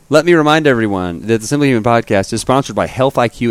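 ordinary delivery

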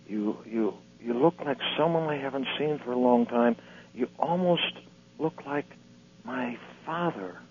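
dropping to a soft, awed hush; hushed wonder. Acted. Jesus musing quietly to the old man, hushed and awed. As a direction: let it fade as the truth dawns. Jesus voiced softly in joke.

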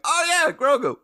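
high, loud burst of recognition; Jimmy. Retold or imitated. Host imitating Jimmy's excited blurt, high and loud. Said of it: "Jimmy's like".

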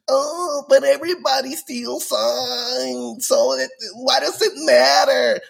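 high mock-whining fan with a fake sob; whiny fan. Mocking Astros fans, whiny high fake-sobbing complaint. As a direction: whine it like a sore loser caught cheating. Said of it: "they were like".

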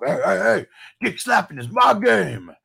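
loud, high mock holler; hammed-up catchphrase yell; shouted movie catchphrase.

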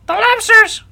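very high, loud Griffin shout. A narrator's reading. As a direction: burst in with a piercing creature shout. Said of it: "shouted the Griffin".

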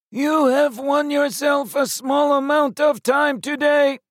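very high metallic alien proclaiming; menacing alien declaration; croaking metallic shout. Acted. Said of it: "the egg shouted".

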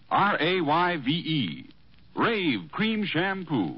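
ordinary talk

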